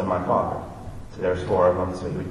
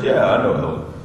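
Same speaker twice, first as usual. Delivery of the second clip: tough biker; 2 semitones lower than usual. Retold or imitated. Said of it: "He goes".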